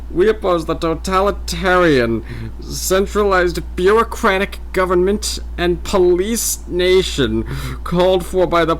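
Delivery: slow, loud Mid-Atlantic orator; Mid-Atlantic accent. Acted. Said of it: "mock Mid-Atlantic voice".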